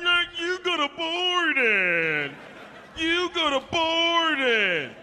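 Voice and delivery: loud, high taunting chant, slow and drawn out; mocking kids. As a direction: jeer like schoolyard kids. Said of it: "They're like".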